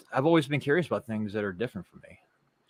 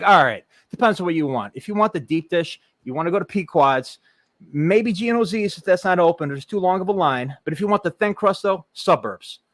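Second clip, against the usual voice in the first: put-on thick Chicago tour-guide accent, pitched high and loud; Chicago accent, showing tourists around; 8 semitones higher, 6 dB louder than usual. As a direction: lay on the Chicago accent, eager local. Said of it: "The way you say Chicago".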